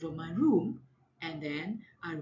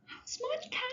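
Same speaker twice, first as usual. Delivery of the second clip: much higher, softer sweet auntie; sweet neighbor; 6 dB quieter, faster than usual. Acted. Neighbor lady sweetly asking about the child. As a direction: ask in a syrupy neighbor voice. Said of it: "And then she said".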